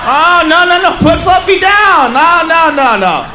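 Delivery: loud, high pleading no's, slowed; dad shouting at his son to put the cat down; alarmed parent. Acted. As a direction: stop the kid before it's too late.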